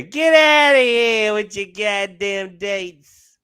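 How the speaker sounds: slow, drawn-out mock Fauci voice; lightly put-on Fauci scolding; a hint of a nagging health official; Fauci impression. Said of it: "you sound like fucking Fauci".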